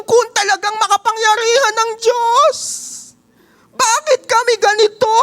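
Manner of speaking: hammed-up whining doubter; preacher voicing Gideon's complaint to God, high whine; whiny Gideon. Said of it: "Sabi ni Gideon".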